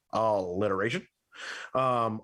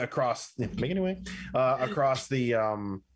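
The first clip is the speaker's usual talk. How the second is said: usual speaking voice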